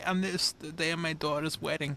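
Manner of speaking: a hint of the aging Godfather. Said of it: "I can't do that voice".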